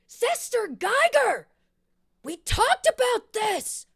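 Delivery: slow, high, loud call upstairs; exasperated yell. Acted. Woman scolding Sister Geiger after metal falls, exasperated yell. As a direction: holler it up the stairs, drawn out and scolding.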